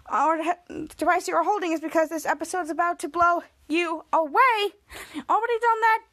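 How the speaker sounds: ordinary talk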